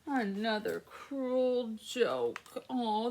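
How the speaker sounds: usual speaking voice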